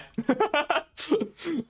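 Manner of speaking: high giggling laugh. Real, not acted. Laughs after his own morbid joke.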